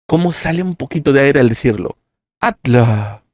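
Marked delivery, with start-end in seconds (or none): 2.4-3.2 breathy atla. Shown as a demonstration. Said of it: "como si se estuvieran quedando sin aliento".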